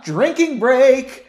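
high, loud, drawn-out singsong call; announcing a drinking break. Pretend. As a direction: call it out like a game cue.

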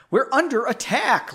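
high, urgent alarm call; shouted. Acted. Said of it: "Leonid shouted".

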